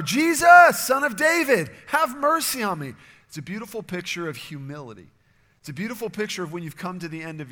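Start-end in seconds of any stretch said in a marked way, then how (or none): 0.0-2.9 even louder, high pleading shout; crying out louder. Retold or imitated. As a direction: shout over the crowd, begging. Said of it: "he cries out even louder".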